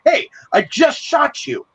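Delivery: high, slow squeaky goblin voice; a dim little goblin amazed at what he did; surprised goblin. Retold or imitated. Goblin telling another he shot him, squeaky. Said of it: "he's like".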